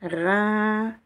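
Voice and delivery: Re with the vowel held long; lengthened vowel. Shown as a demonstration. Teacher repeating the long vowel for learners, drawn out. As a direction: stretch the vowel in Re steadily. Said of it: "Je prononce un peu plus long que d'habitude".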